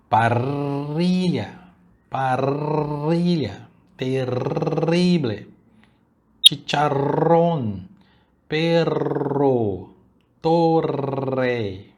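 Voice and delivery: "Parrilla", "terrible", "torre" very slowly, the RR trilled long; exaggerated slow rolled RR; long trilled double R. Shown as a demonstration. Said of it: "tente tremer o doble R por um pouco mais de tempo".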